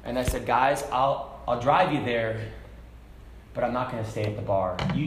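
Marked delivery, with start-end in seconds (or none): none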